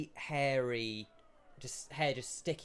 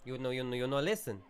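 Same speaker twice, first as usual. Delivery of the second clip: louder, higher, fast mock Italian shopkeeper; shopkeeper accent; 5 semitones higher, 7 dB louder, faster than usual. Shopkeeper brushes off customer, louder fast mock Italian accent. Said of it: "So he goes".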